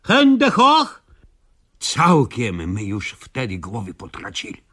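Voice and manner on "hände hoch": high, loud barked German order; German command. Acted. Anaconda suddenly orders villagers in German, loud high bark. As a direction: shout it like a German soldier. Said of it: "ludzkim głosem odezwała się".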